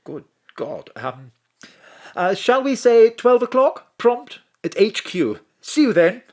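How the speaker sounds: put-on posh English accent, caught off guard; a startled English gent recovering his poise; Jennings in flustered English accent. Mr Jennings flustered by her slip on the phone, in a posh English accent. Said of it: "a plumbing English accent".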